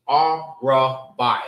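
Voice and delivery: Ar, Ra, Bai, each sounded apart; teacher counting out Ar, Ra, Bai by syllable; three syllables. Shown as a demonstration. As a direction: break it into Ar... Ra... Bai. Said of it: "Three syllables".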